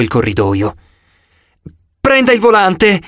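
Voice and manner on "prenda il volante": panicked begging; pleading. A narrator's reading. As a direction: beg him to take the wheel. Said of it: "lo implorò Grover".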